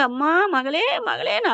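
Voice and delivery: high, keening mock cry; retold wailing. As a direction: imitate parents wailing over a daughter. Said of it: "அழுகுறாங்க".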